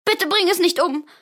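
high, loud tearful begging; a girl begging her father for the piglet's life; sobbing plea. Acted. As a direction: plead through tears. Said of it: "schluchzte sie".

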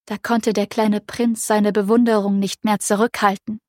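ordinary delivery, nothing marked

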